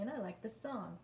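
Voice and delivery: ordinary talk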